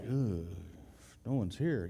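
soft, let-down groan; mock sigh. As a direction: sigh it out, disappointed. Mock disappointed mourner at funeral.

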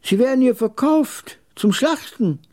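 put-on very high-pitched, emphatic old man; high old man's declaration; old camel trader. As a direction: pronounce it in a strained-up trader's voice. Old camel trader announces the slaughter.

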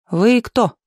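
strict gatekeeper's query; stern. A narrator's reading. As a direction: question the stranger strictly. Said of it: "строго спросила вахтёрша".

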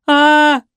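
high, loud piercing "A!" scream; hysterical scream. Acted. Woman screams hysterically in the yard. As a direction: shriek in terror. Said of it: "истошно кричала женщина".

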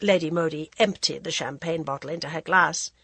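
ordinary delivery, nothing marked

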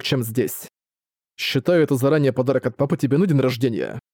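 ordinary delivery

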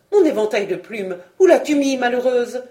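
loud, frantic demand; impatient scolding. A narrator's reading. Frog queen hunts her fan, loud impatient scolding.

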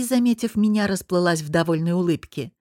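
ordinary delivery

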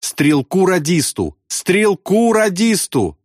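high, drawn-out call; wailing cry. A narrator's reading. Pilot naming the wounded gunner, high wail. Pilot wails answer over intercom.

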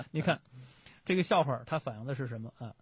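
ordinary talk, nothing marked

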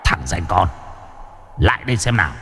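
a ghostly summons, low and soft; soft, low, eerie call. Acted. Mysterious voice beckons.

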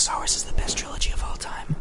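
hushed whispered aside. Real, not acted. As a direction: whisper it like a secret. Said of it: "whispered aside".

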